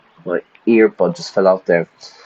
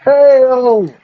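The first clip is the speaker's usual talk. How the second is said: high, slow, loud patriotic cry; rallying salute; 6 dB louder than usual. Acted. Soldier salutes the president, loud patriotic cry. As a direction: bellow it like a soldier saluting the nation.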